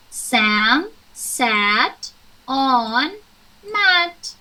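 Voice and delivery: very slow, word by word; slow reading. Shown as a demonstration. Teacher reading the sentence to pupils, very slow. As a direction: read it out slowly for beginners. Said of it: "the magic of learning sounds".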